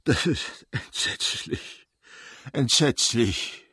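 slow breathy despairing whisper; husband aghast at himself, whispering; despairing repeat. Acted. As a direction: let it sink in, slowly, in despair. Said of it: "wiederholte er".

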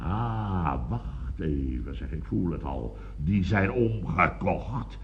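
slow, low and soft; dawning suspicion. Acted. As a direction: let the grim realisation creep in. Dark realisation burglars were bribed.

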